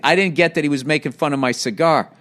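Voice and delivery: ordinary talk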